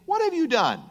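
high-pitched indignant question; challenging demand. Acted. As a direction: call someone to account, pitched well up. Quoting someone challenging God.

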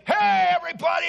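loud, high, fast brash call to the crowd; rude shout. Acted. Preacher mocking a rude, attention-grabbing Jesus with a loud shout. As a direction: bellow it out like an obnoxious showman. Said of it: "it didn't come that way".

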